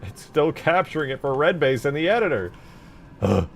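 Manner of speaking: high, loud frustrated complaint; exasperated. Real, not acted. Streamer whose game editor keeps capturing wrong, exasperated. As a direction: raise your voice in real exasperation.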